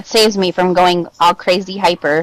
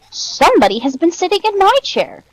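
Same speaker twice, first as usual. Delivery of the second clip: higher mama bear voice; middle-sized Mother Bear; 9 semitones higher than usual. A narrator's reading. Mother Bear finds her chair disturbed, higher middle-sized voice. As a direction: lift it lightly as mother bear. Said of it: "said in her li-, middle-sized voice".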